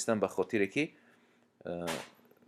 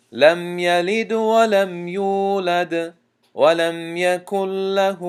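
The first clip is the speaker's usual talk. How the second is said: slow melodic tajweed chant, drawn out; Quran recitation; 8 semitones higher, 6 dB louder, much slower than usual. Shown as a demonstration. Teacher recites al-Falaq to students in slow tajweed chant. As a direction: recite each verse slowly, measured and melodic.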